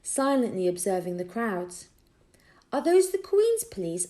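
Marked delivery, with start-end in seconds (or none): 2.7-4.1 put-on boy's eager question. A narrator's reading. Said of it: "asked Tom".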